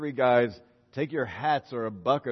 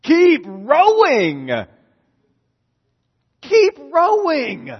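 slow, loud and much higher, drawn out; emphatic shout; 11 semitones higher, 13 dB louder, much slower than usual. Real, not acted. Preacher shouting the answer to the congregation, slow and loud. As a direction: call it out like a rallying cry. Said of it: "What should they have done?".

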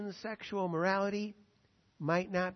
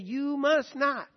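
slow, raised-pitch retold command; firm prohibition; 6 semitones higher, 4 dB louder, slower than usual. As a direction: lay down the rule slowly, pitched up.

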